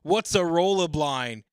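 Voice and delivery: loud, high, slow Aussie voice; slow Australian accent. Acted. Reading a confused answer in an Australian accent. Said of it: "in my Down Under accent".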